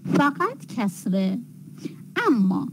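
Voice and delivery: ordinary talk, nothing marked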